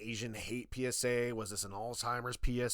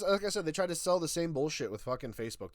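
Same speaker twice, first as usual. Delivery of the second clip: usual speaking voice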